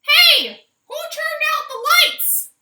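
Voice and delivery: high, loud startled holler; hollering hen. Acted. Minerva blinded by a hat, hollering high and loud. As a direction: shout it from under the hat. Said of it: "hollered Minerva Louise".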